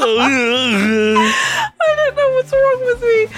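slow, high, whiny cry of self-despair; exasperated wail. Real, not acted. Host teased for forgetting, wailing in exasperation. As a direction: whine it out high and drawn-out. Wailing at own failed memory.

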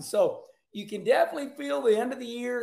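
ordinary talk

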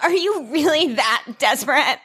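high-pitched imitation of a taunt; mock scornful. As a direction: needle a rival with exaggerated disbelief. Retold taunt in a quarrel.

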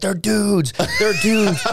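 high, urgent mock alarm; retold panicked warning. Friend bursts in to warn his drunk buddy, mock panic.

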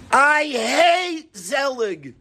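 high, drawn-out outburst of anger; high-pitched, slow, seething complaint; smoking-mad kid. Acted. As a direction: act out a furious kid storming home. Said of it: "He's smoking mad".

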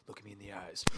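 menacing low murmur. Retold or imitated. John Wick trailer villain, low and menacing. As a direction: pull them in close and low. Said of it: "he's just like".

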